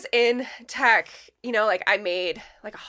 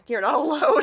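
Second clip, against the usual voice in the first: laughing; 6 semitones higher, 6 dB louder than usual. Real, not acted. Laughing echo.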